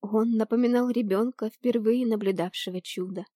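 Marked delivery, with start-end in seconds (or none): none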